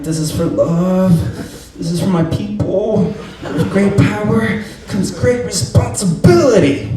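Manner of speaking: high, slow, hammed-up hero vow; heroic movie speech. Straining under the squat, vowing like a hammed-up movie hero. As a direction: ham it up like a movie hero finding strength. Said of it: "I'm like".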